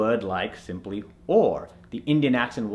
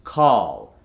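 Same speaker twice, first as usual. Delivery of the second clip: American accent; 3 semitones lower than usual. Shown as a demonstration. Coach gives the converted word, American accent. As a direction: call with a long open "ah". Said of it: "to the American accent".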